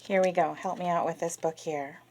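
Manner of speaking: plain reading voice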